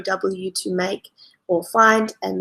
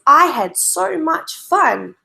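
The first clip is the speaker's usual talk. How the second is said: higher, louder and slow, full of excitement; exciting exclamation; 7 semitones higher, 4 dB louder, slower than usual. Shown as a demonstration. Teacher demoing an exclamation sentence for kids, excited. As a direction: sell it like the best day ever. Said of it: "an exclamation mark makes it sound exciting".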